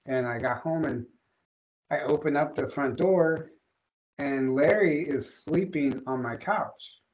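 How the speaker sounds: ordinary talk, nothing marked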